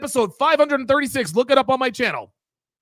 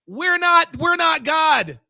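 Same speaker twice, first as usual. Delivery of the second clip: ordinary talk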